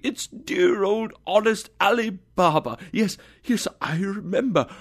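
high, excited outburst; thrilled, delighted remembering; Scrooge. A narrator's reading. Scrooge recognises his boyhood storybook hero, excited. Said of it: "Scrooge exclaimed excitedly".

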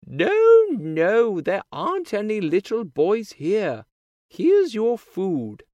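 high-pitched giant's wife, loud at the start; big woman's voice. A narrator's reading. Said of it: "says his woman".

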